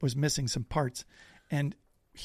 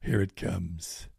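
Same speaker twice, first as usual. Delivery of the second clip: dread; 6 semitones lower than usual. Retold or imitated. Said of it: "And I'm like".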